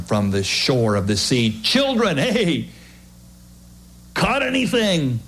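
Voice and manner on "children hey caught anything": high call across the water. Acted. Jesus calling from the shore to the fishermen.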